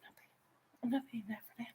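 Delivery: hushed unvoiced whisper. Quoting herself refusing insole price, whispered. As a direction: mutter it under your breath in a whisper. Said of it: "I'm like".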